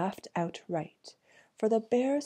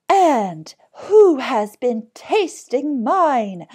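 higher, very loud, slow cry; loud, raised, drawn-out storybook bear; middling-sized bear voice; 6 semitones higher, 12 dB louder, slower than usual. A narrator's reading. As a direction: call out slowly as the middle bear. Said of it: "in a middling-sized voice".

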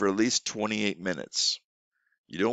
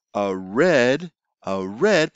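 teacher prompt; 2 semitones higher, 4 dB louder, much slower than usual. Shown as a demonstration. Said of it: "You could say".

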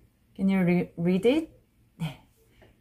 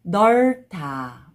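usual speaking voice

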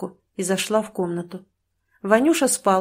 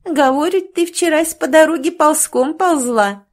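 laughing; 8 semitones higher, 7 dB louder than usual. A narrator's reading. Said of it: "рассмеялась одна из женщин".

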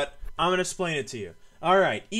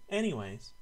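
quiet reset; 7 semitones lower, 8 dB quieter than usual. Real, not acted. Sudden calm after yelling.

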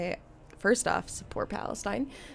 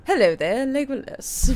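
louder, higher mock RP greeting; British elf accent; 5 semitones higher, 7 dB louder than usual. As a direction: play an elf in proper British. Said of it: "elves always have British accents".

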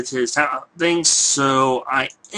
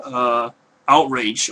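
normal talking voice

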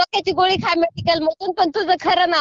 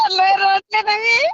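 high, drawn-out, through laughter; laughing admission; 6 semitones higher, slower than usual. Real, not acted. Woman telling the prankster she cried, slow laughing voice. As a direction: admit it slowly while laughing.

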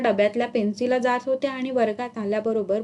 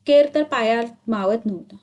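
usual speaking voice

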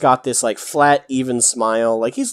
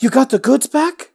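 much higher, pleased surprise; surprised question; 13 semitones higher, 4 dB louder than usual. Acted. Nova brushing past Boric to the party, surprised. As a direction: perk up at the news. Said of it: "It's like".

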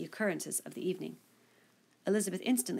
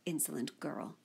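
softly, under her breath, a touch of indignation; a low aside only she should hear; said to herself; 3 semitones lower, 7 dB quieter than usual. A narrator's reading. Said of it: "said Elizabeth to herself".

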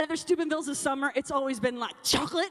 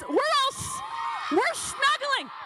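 calling out; 10 semitones higher, slower than usual. Real, not acted. Youth speaker calling to crowd.

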